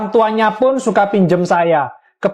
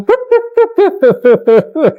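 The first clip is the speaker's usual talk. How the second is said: a high, mocking cackle; high mocking laugh; 9 semitones higher than usual. Father mocking his crying child with a high laugh. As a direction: cackle high at the kid's bad luck.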